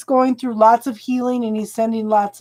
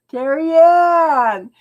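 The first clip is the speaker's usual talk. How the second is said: name drawn out slowly through a laugh; amused drawn-out laugh on the name; 4 semitones higher, 4 dB louder, much slower than usual. Real, not acted. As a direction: laugh while you say her name. Laughing at the viewer's request.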